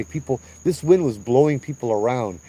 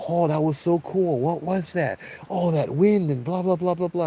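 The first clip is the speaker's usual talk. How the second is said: high-pitched imitation of a gushing crowd; mock excited babble running into blah-blah; excited yapping; 7 semitones higher than usual. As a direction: mimic everyone chattering at once, pitched up. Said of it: "they were just yapping and yapping".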